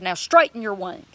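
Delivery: loud, insistent order; commanding. Retold or imitated. Believer repeating the order to an injured bird, commanding. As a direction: raise the voice and command it. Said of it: "started commanding the bird".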